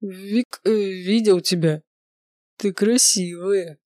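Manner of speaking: медленно, заплетающимся языком; тянет слова, как пьяный; пьяная невнятная речь. Acted. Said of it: "заплетающимся языком начал алкаш". (пьяный, язык заплетается)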